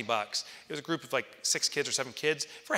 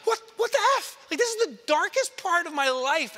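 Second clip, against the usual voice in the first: much higher, louder shocked cry; panicked outburst; 8 dB louder than usual. Retold or imitated. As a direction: yelp in disbelief. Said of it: "I'm like".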